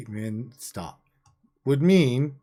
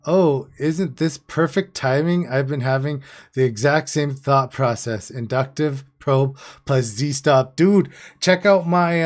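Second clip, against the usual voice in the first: excited and a bit louder; real excitement; 5 dB louder than usual. Real, not acted. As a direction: burst in with an eager discovery. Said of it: "so I say that excitedly".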